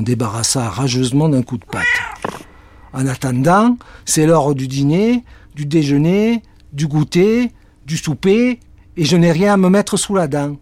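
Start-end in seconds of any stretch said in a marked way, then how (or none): 2.9-10.6 louder, rising higher each meal; hammed-up hungry cat; complaining cat. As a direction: list every meal, climbing.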